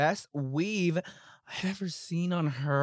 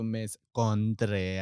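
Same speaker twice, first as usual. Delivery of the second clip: low teasing drawl; 7 semitones lower than usual. Pretend. Teasing formal address, surprise.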